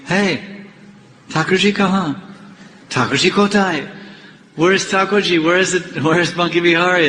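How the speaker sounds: high-pitched, urgent searching questions; mock alarm. Pujaris discovering the deity is missing, mock alarm. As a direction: act out a priest's startled panic.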